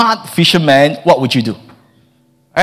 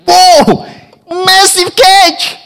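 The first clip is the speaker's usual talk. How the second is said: high, stretched-out cry of amazement; amazed shout; 3 dB louder, much slower than usual. Acted. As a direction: gasp it out, stunned by the haul. Simon amazed by huge catch.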